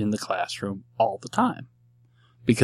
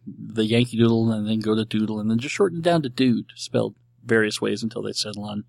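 normal talking voice

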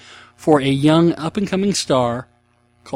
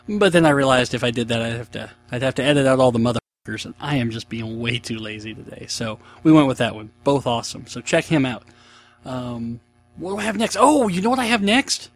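ordinary delivery

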